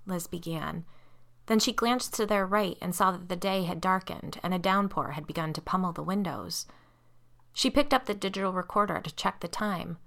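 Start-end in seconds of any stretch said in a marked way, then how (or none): none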